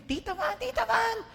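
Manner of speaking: put-on high child's call, rising. (mataas na tawag ng bata)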